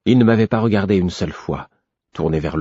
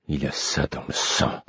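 lower, softer mutter; 4 semitones lower, 7 dB quieter than usual. A narrator's reading. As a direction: grumble it to himself. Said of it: "l'entendis-je marmotter". (marmonné à voix basse)

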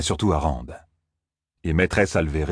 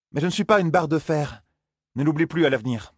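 higher, teasing complaint; Zarine, wry young woman; 9 semitones higher than usual. Acted. Zarine to Perrine after being hoisted, higher and wry. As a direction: tease him for his grip.

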